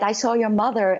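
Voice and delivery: ordinary talk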